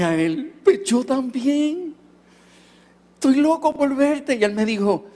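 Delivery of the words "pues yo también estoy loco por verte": eager reply. Retold or imitated. Eager reply to his father.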